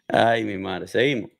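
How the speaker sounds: normal talking voice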